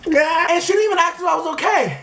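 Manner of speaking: high, wounded whine; hurt complaint. Acted. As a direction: protest in a high, upset voice. Still upset about the driver.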